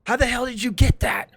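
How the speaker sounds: high, loud acted demand; forceful in-character shout; yelling out. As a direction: yell the question in outrage. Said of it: "and I yell out".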